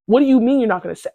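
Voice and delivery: fast, loud, angry outburst; angry yelling. Retold or imitated. Acted quarrel with crying roommate.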